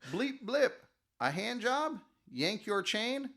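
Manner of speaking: high, slow robot sales pitch; polite butler robot. Acted. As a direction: offer it sweetly like a robot servant. Fleshbot offering its service.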